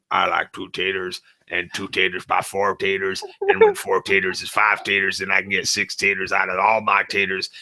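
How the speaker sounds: low, monotone deadpan ramble; Eugene. Shown as a demonstration. [name] impersonating Eugene talking taters to Morgan, low deadpan. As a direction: keep it low and even, a dim-sounding drone. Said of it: "Here's my impression of Eugene".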